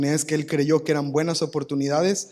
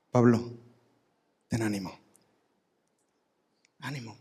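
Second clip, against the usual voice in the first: tender reassurance; 5 semitones lower than usual. Acted. Jesus comforts defeated Paul.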